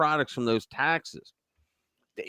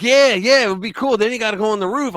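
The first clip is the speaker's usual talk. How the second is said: imitating his son, higher and louder, eager; playful egging on, pitched up; excited son; 7 semitones higher, 6 dB louder than usual. Son egging dad on, retold.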